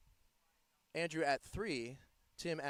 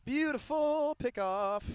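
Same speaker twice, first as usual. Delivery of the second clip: admiring; 10 semitones higher, 8 dB louder, slower than usual. Acted. Commentator praising a play.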